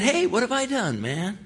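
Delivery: high, put-on voice of a cocky kid; brash kid brother. Kid brother shrugging off his angry older brother, high and cocky. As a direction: toss it back like an innocent youngster. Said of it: "And David said".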